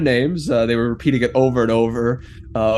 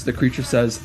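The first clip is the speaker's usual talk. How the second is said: ordinary talk, nothing marked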